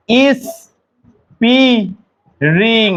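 broken into separate syllables with long pauses; halting, syllable-by-syllable word; wrong slow version. Shown as a demonstration. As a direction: spell it out piece by piece, slowly. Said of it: "এভাবে ধীরে ধীরে নয়".

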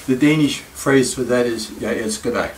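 ordinary delivery

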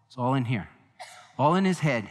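ordinary talk, nothing marked

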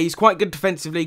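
usual speaking voice

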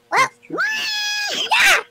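sudden high-pitched yell; mock battle cry. Narrator springs the battle cry on the lady, mock battle cry. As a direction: burst into a wild battle-cry holler. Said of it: "that'll be the battle cry".

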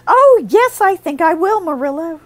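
high, loud bright reply; optimistic. A narrator's reading. Anne answering Marilla with cheerful optimism. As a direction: chirp it hopefully. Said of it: "returned Anne optimistically".

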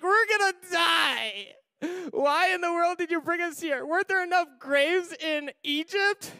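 high, loud, wailing hysterics; dramatic panic. Acted. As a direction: overact doom like a crying crowd.

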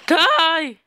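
loud, high cracking yell. Real, not acted. Gamer yelling at an enemy to die, voice cracking. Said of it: "my voice keeps cracking everywhere".

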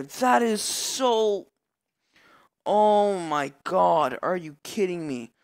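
slow, drawn-out frustrated complaint; real exasperation; exasperated. Real, not acted. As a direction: groan out your disbelief. Frustration after steal.

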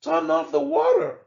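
high, loud annoyed cry; shouting. A narrator's reading. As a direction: yell at the neighbour to stop. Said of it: "people in the house next door cried".

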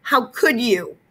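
high, pained accusation; hurt and outraged at mom; accusing. A narrator's reading. Peter accusing his mother, high and hurt.

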